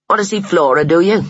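mildly growled, sneering question; growled threat. A narrator's reading. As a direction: challenge him like a bullying mouse. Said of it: "growled Buck".

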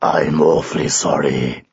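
very low, rumbling apology; deep, rumbly lion. A narrator's reading. Lion apologises to the knight, deep and rumbly. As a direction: drop right down into a big rumbly lion. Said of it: "said a deep, rumbly voice".

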